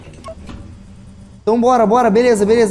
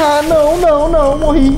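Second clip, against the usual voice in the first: high loud panicked wail; panicked cry; 9 semitones higher, 4 dB louder than usual. Real, not acted. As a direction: cry out as you die. Dying to monster in game.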